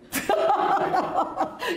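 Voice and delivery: long, high burst of laughter; hearty laugh. Real, not acted. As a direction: crack up at your own punchline. Laughs at the girl's reply.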